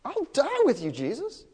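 high, swooping plea, rising at the end; Peter, emphatic pleading. Retold or imitated. Disciple pledging loyalty to Jesus, high and emphatic. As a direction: insist in a high acted voice.

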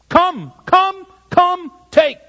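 high-pitched, punched one-word commands; urgent exhortation. Shown as a demonstration. Preacher pressing the verse's four commands, urgent exhortation. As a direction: drive each command home separately and urgently. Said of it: "It's an exhortation to immediate action".

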